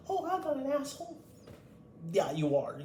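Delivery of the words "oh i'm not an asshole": high, fast sneering mimic of a smug person; high mocking imitation; mocking whiny voice. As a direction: squeak it out like a self-righteous snob. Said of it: "you may think that".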